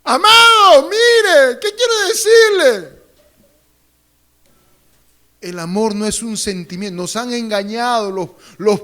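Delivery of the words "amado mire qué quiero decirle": loud, high-pitched call for attention; raised, insistent preacher's call. Real, not acted. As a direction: shout over the crowd to regain them. Calling the laughing congregation back.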